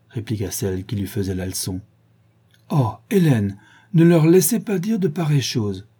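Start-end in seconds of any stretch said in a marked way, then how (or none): 2.7-5.9 higher-pitched, louder distressed plea; very troubled. A narrator's reading. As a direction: plead with mild alarm. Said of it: "très troublée". (supplication troublée, plus aiguë)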